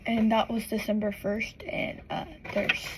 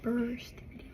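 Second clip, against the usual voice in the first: whispered; 8 dB quieter than usual. Real, not acted. Aside to camera.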